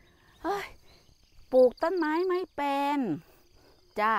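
high, loud mocking jeer; mocking doubters. Mimicking people who scoffed at her tree planting, loud mock jeer. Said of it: "หลายคนก็บอกว่า".

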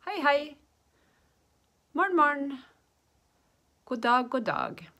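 doubled greetings, high and loud; language-teacher demonstration. Shown as a demonstration. Teacher doubling up on greetings for learners, loud demonstration.

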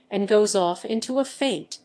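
plain reading voice

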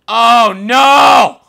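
very loud, high wail of dismay; drawn-out, high-pitched yell; horrified scream. Real, not acted. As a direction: scream it out, drawing out the words. Gamer realises there is no sewage.

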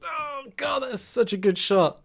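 high-pitched cry easing down in pitch; agonised admiration. Real, not acted. Gamer reacts to a shot off the post, agonised admiration. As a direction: still high from the excitement, coming down.

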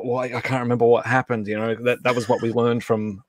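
ordinary talk

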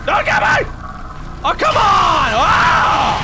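high panicked gamer yelps; high, frantic pleading; panicked shouting. Real, not acted. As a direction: cry out as the monster closes in. Being chased in game.

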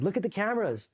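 high-pitched insistent plea; retold protest. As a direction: act out insisting on your innocence. Protesting innocence under interrogation.

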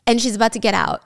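normal talking voice

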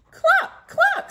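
very high, loud hen cluck; clucking cow. Acted. Teacher voicing Cow for her class, high loud clucking. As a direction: cluck like a chicken. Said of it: "said Cow".

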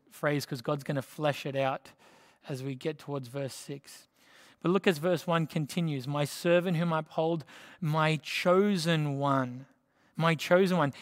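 normal talking voice

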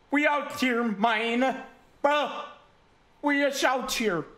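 high, loud triumphant hollering; exaggerated high-pitched boast to the crowd; hammed-up celebration. Celebrating being safe on YouTube.

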